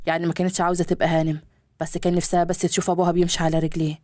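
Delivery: a father's low gentle voice, pitched well below the narration; calm low fatherly pleading. Acted. Father coaxing his son back to his mother, low and gentle.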